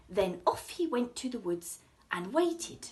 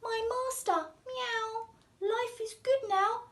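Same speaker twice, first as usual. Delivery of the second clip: higher, pleased cat voice; contented cat; 12 semitones higher than usual. Acted. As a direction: purr out your satisfaction.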